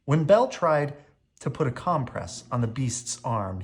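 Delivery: ordinary delivery, nothing marked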